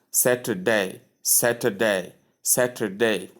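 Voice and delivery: Saturday with all three syllables, middle one softened. Shown as a demonstration. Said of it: "you pronounce the three syllables".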